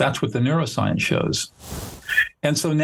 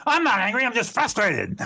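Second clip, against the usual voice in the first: much higher, sharp and huffy "I'm not angry"; indignant denial; 10 semitones higher than usual. Shown as a demonstration. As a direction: snap back, offended at the very idea. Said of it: "Like that, right?".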